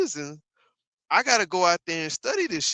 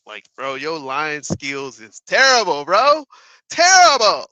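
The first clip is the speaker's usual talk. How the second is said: slow rant that bursts into a shout; 2 semitones lower, 10 dB louder, slower than usual. Real, not acted. Streamer yelling at Trump over his lying, slow then loud.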